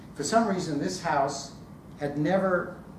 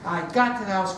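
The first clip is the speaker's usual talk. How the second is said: ordinary delivery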